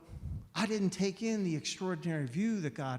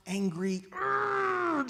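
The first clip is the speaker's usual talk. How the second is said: sharp, high, angry growl of frustration; angry grunt; 12 semitones higher, much slower than usual. Retold or imitated. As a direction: let out an abrupt angry urgh. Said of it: "just like this".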